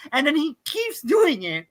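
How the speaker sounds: ordinary talk, nothing marked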